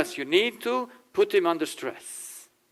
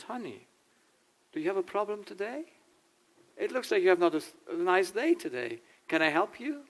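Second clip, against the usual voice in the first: higher, soft tender concern; caring boyfriend; 4 semitones higher, 5 dB quieter than usual. Retold or imitated. Boyfriend softly asks his girlfriend about her bad day. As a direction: gently ask a troubled partner what's wrong, pitched up and soft. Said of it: "And if he says".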